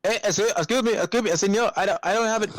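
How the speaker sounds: high-pitched, stumbling interruption; imitated customer. Customer trying to get the worker's attention, high flustered imitation.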